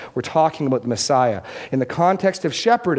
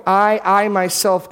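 I, I myself slow, louder and higher; emphatic I myself; 5 semitones higher, 4 dB louder, slower than usual. Shown as a demonstration. Preacher echoing God's repeated words: emphatic I myself. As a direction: repeat the I with weight. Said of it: "God says repeatedly".

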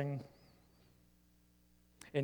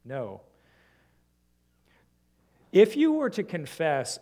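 ordinary delivery

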